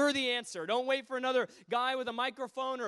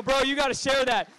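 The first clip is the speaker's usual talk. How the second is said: loud, higher exhortation; excited urging; 4 semitones higher, 12 dB louder than usual. Retold or imitated. Preacher urging the young Brit to share, loud and excited. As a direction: burst out loudly, urging him on.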